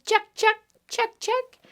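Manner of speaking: high squeaky squirrel chuck chuck; squirrel chatter. Acted. Repeat, squirrel sound.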